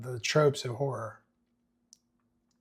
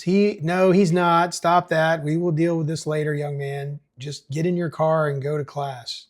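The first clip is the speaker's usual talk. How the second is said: loud stern; 4 semitones higher, 6 dB louder than usual. Acted. Mayor shouts down teens.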